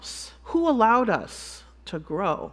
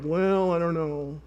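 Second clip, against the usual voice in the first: slow, drawn-out reluctance; dragged-out, noncommittal mumble of doubt; doubtful hedging; 4 semitones lower, 4 dB quieter than usual. Retold or imitated. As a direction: stall as you waver over the answer. Voices the audience's doubtful answer.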